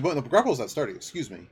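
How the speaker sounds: ordinary talk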